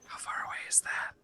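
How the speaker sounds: hushed, voiceless whisper; whispered aside. Real, not acted. Player quietly checks a fact with the game master, whispered. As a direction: whisper it discreetly, soft and low-key.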